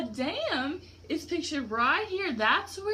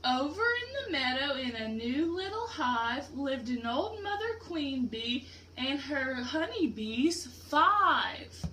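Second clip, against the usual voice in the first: ordinary delivery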